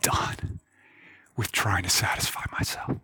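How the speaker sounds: breathy whisper, barely voiced; whispered confession. Preacher voicing a humble heart to Jesus, whispered. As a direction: let the words fall away to a whisper. Confessional prayer, giving up self.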